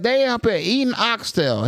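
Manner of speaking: high, drawn-out retelling of a stand-up bit; comedian's joke delivery. Retold or imitated. Retelling Cat Williams' oxtail joke, high comic impression. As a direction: push it up high and stretch it. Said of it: "he was saying a joke where".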